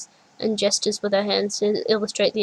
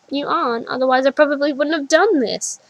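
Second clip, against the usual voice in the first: rising, louder frustrated reply; getting louder; 5 semitones higher, 6 dB louder than usual. A narrator's reading. Adult self blames the child, voice rising. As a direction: push it up in anger. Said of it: "My voice is getting louder".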